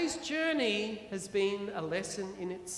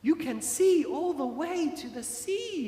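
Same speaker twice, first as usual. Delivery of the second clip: a mild exclamation, a touch higher; exclaiming; 6 semitones higher than usual. Acted. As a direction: show the view with light wonder. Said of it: "exclaimed Lin Sue".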